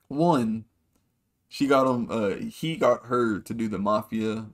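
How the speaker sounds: ordinary delivery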